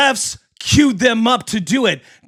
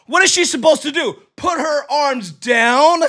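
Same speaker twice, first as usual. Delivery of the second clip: usual speaking voice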